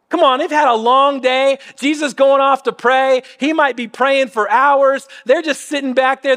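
ordinary talk

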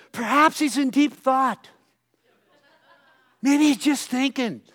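high sarcastic taunt with a laugh; mocking. Elijah mocking Baal's silence, sarcastic and laughing. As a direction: tease them with mock concern. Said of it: "Then he says".

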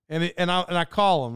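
usual speaking voice

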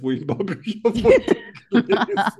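laughing. Real, not acted. Laughing while asking the question.

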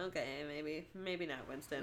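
soft to cat. Real, not acted. Talking softly to cat.

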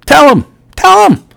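emphatic urging. Real, not acted. Urging podcast listeners to tell friends, high and insistent. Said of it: "urging listeners".

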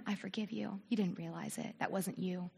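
low, hushed forgiving reply; acted quiet, low reassurance; soft forgiveness. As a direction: murmur it gently, pitched well down. Acted forgiving reply to being hurt.

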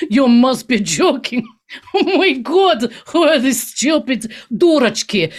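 mock Putin laughing in disbelief; hammed-up scornful Russian leader; imitating Putin. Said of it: "he'll be like".

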